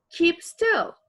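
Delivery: "keep still" high and loud; loud order. Acted. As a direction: bark it at him. Acted command vs reported.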